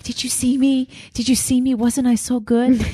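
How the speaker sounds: put-on high, eager little-kid voice asking mom for praise. Second grader asking her mom for praise, eager and high.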